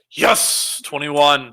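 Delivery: loud, excited burst over a good roll; real gamer's victory shout; triumphant cheer. Real, not acted. Celebrates a high dice roll.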